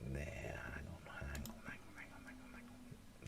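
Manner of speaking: soft unvoiced whisper. Real, not acted. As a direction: whisper to yourself while adjusting. Fiddling with camera, whispering.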